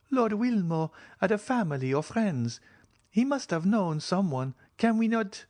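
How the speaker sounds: put-on high, pressing young woman's voice; insistent young woman. Julie insists to the count, high and pressing. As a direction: keep pushing, cut off at the end.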